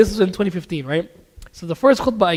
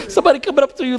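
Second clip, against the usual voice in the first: laughing; 6 semitones higher, faster than usual. Real, not acted. Laughs recalling a suspicious man.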